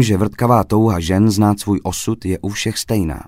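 plain reading voice

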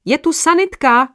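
high loud call out; shout. A narrator's reading. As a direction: shout the news across the hall. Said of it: "vykřikl někdo".

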